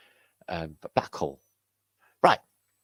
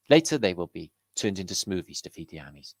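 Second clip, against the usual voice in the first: ordinary talk, nothing marked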